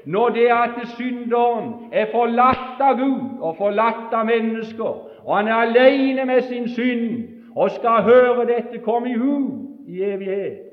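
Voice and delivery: loud, high revival preaching; fervent preaching. Acted. Preacher warning sinners, loud and high. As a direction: thunder it out to the congregation. Preacher at full force opening.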